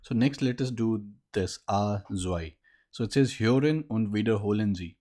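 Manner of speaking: ordinary delivery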